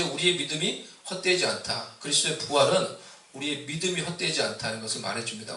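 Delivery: usual speaking voice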